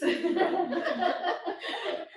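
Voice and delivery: high burst of real laughter. Real, not acted. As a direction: laugh at your own mistake. Laughs at her own slip.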